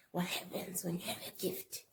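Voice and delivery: soft whisper; hushed explanation. Retold or imitated. Elders telling her about her gift, soft whisper.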